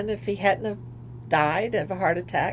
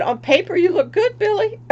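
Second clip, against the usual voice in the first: quoting doctor; 11 semitones higher, 4 dB louder than usual. Retold or imitated. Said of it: "my doctor said".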